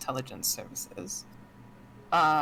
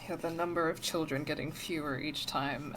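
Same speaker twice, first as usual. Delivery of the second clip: ordinary talk, nothing marked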